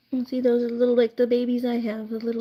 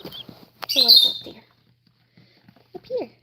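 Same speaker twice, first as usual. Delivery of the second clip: whisper; 4 dB louder than usual. Acted. Voicing birds' wish.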